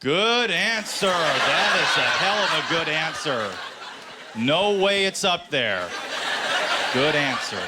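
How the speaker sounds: high, slow, over-the-top cheer; cheering family. Retold or imitated. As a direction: egg her on like a cheering family. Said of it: "her family's like".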